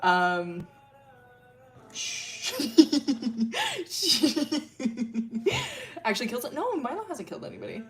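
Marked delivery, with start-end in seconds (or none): 1.9-6.0 long high real giggling fit; helpless laughter. Real, not acted. Streamer cracking up over the lore, helpless laughing.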